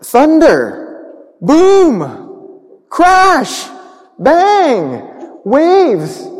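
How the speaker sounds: rising, booming storm words; storyteller building the storm for children; dramatic storm sounds. Acted. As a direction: paint the thunder with your voice.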